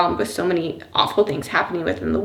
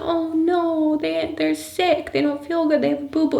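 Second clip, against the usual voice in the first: put-on sad child; 8 semitones higher than usual. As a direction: pity them like a small kid. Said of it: "She said".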